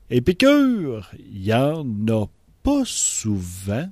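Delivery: put-on pretty tagline voice. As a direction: stretch the show name out showily. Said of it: "j'ai-tu une belle voix pour dire ça".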